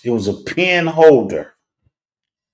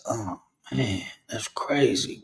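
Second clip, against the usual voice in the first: stunned murmur; 9 dB quieter than usual. Retold or imitated. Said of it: "I said".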